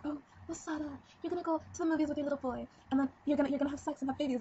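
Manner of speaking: high, mocking mother voice; mocking mom. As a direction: imitate a pushy mom, pitched high. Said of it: "she started talking like".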